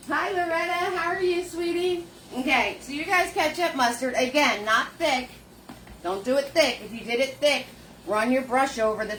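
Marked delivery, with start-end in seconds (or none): none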